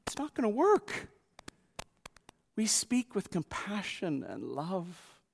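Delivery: ordinary talk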